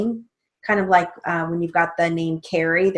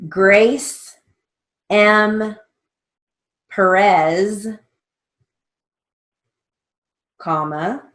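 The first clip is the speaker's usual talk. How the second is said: slow, deliberate dictation read with pauses; 3 semitones higher, 5 dB louder, much slower than usual. Shown as a demonstration. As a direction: dictate each word slowly and clearly for the class. Dictation with spelled name.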